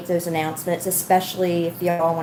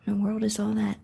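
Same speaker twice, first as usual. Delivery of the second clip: ordinary talk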